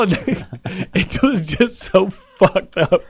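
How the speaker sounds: ordinary talk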